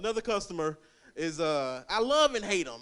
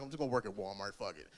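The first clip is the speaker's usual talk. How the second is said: resigned mutter; 9 semitones lower, 9 dB quieter, faster than usual. Retold or imitated.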